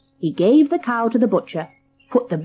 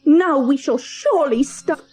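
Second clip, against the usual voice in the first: angry mother; 6 semitones higher than usual. Acted. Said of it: "very angry".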